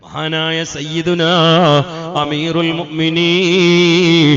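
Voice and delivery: a drawn-out, rousing sermon chant; full-force preaching. Acted. Chanted preaching praising Umar.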